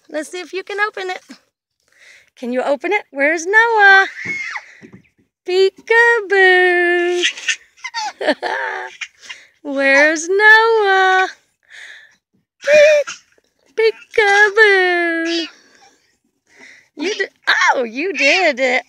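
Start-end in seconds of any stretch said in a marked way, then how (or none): 3.1-4.1 calling out loud and bright; playful loud call. Real, not acted. Grandma to baby during peekaboo, loud playful call. 5.4-7.2 drawn-out, loud peekaboo; slow loud peekaboo. Real, not acted. Grandma popping out at baby, slow loud peekaboo. 9.6-15.5 loud calling build into slow peekaboo; loud excited peekaboo. Real, not acted. Grandma hiding from grandson, loud excited peekaboo.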